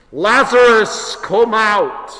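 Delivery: slow, high, booming summons; commanding shout. Acted. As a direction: shout it slowly into the tomb, drawing out the name. Jesus calling into the tomb, final.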